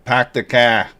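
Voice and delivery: mock Boston "Pack the car" with dropped r's; a light imitation of a Boston accent. Chatting with viewers about Boston speech, mock Boston accent. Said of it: "how they say it in Boston".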